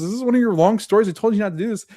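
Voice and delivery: high, quick, exasperated scolding; exasperated daughter. Retold or imitated. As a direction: cut her off, fed up with the rambling. Daughter scolding mom's long stories.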